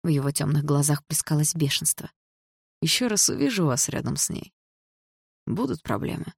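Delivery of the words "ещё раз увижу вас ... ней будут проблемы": quiet threat. A narrator's reading. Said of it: "Тихо, но с угрозой в голосе произнёс он".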